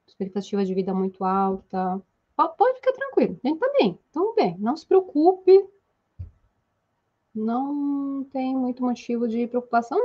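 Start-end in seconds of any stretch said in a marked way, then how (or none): 2.4-5.6 reassuring. Acted. Reassuring the worried learner.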